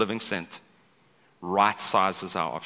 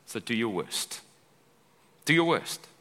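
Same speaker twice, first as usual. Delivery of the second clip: mock defiance. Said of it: "Nehemiah goes".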